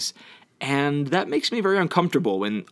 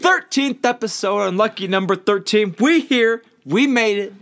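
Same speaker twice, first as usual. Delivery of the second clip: dramatic hype; 9 semitones higher, 5 dB louder than usual. Acted. Dramatic hype for thirteenth episode.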